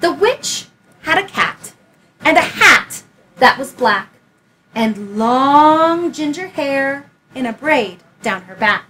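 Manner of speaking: put-on lively read-aloud for a child; story narration. A narrator's reading. Teacher reading Room on the Broom aloud, lively storytelling. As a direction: tell it like a bedtime picture book. Reads picture book aloud.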